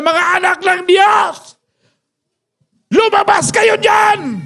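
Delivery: high, slow taunting giant's bellow; mock giant's shout; Goliath shouting. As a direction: bellow the challenge like a giant calling out the army. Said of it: "nung nagsisigaw na si Goliath".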